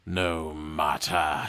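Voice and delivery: low, very slow dismissal; drawn-out, low wizard scoff. A narrator's reading. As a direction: wave it off like a haughty sorcerer. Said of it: "scoffs the wizard".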